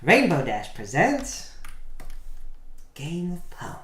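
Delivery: lightly voiced sparkly pony announcer; Rainbow Dash voice. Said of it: "do it in a Rainbow Dash voice".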